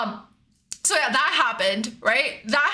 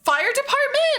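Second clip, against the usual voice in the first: alarmed neighbor; 12 semitones higher than usual. Retold or imitated. Neighbor voiced, alarmed question.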